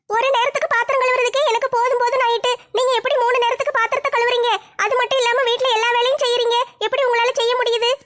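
younger sister voice. Acted. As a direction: pitch up as the weary little sister.

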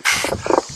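short puff of dismissal; voiceless, derisive raspberry puff; scoffing pfft. Acted. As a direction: blow air out in mock contempt.

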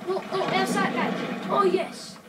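loud, high burst at the game; real high-pitched gamer shout; excited shout. Real, not acted. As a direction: cry out loudly mid-fight. Reacting to enemy in live game.

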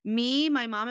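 normal talking voice

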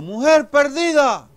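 high, slow, loud audience call; shouted song request. Acted. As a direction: holler a title to the singer. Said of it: "Dice otro". (petición a gritos, alargada)

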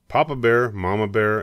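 ordinary delivery, nothing marked

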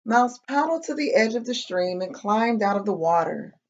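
animated read-aloud with bouncy pitch; children's story narration. A narrator's reading. Reading a picture book to kids, lively storytelling. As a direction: tell it to little listeners, pausing before each mishap.

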